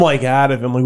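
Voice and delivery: ordinary talk, nothing marked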